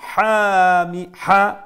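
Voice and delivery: loud chanted recitation of the phrase; tajweed recitation, ringing out; recited demo. Shown as a demonstration. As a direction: recite it high and strong with clear izhar. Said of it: "That is known as izhar".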